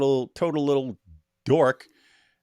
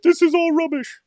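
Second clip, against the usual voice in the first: high, louder mock whine; mock whiny opponent; 5 dB louder than usual. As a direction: squeal it like a sulking heckler. Mocking opponent's scribbled note.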